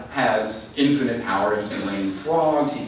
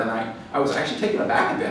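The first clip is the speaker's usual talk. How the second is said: ordinary delivery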